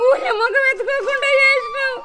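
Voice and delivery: anguished crying wail; wailing lament. Acted. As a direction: drag the despair out through tears. Mother wails about village shame.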